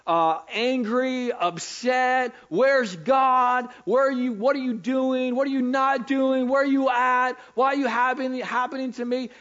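high, loud pleading questions to God; frustrated believer. Acted. Believer asking God where he is, high and loud. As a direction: cry out to God, lost and upset.

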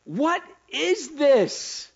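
slow, loud, high question; drawn-out question. Real, not acted. Preacher asking the room, slow and loud. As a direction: stretch every word, loud and high.

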